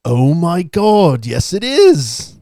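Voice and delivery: teacher showing the shortcut works, with slow hammed-up wonder; mock amazement. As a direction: ham up the astonishment.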